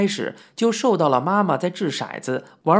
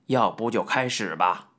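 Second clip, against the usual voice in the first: suggestion; 7 semitones lower than usual. A narrator's reading. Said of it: "似乎在说".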